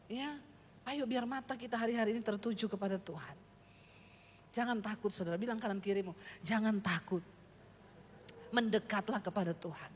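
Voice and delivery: preacher calling the church to stand and sing, soft and low; soft low invitation. Acted.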